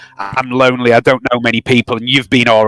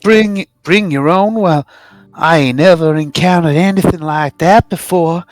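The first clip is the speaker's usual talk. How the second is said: higher, folksy rural voice, a little embarrassed; Brandley, sheepish; 5 semitones higher than usual. Acted. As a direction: play the bashful country newcomer. Player voices Brandley character.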